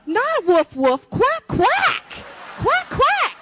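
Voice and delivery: high, loud duck quacks flung out; quacking like a duck. Real, not acted. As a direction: quack like a frantic duck to make it clear. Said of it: "quacking like a duck".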